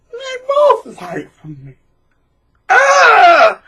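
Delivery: very high, slow gloat ending in a loud shriek; mock gloating parent. Narcissistic parent gloating over fighting children, mocked. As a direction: crow with delight, then squeal.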